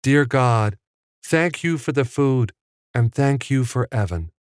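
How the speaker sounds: put-on high little-boy voice saying grace; small boy's high voice at prayer; Richie. A narrator's reading. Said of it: "Richie was the one who set it".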